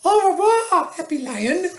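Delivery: very high French lady voice; high, sing-song French accent farewell. A narrator's reading. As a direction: trill it sky-high as a French madame. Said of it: "That's my French lady accent".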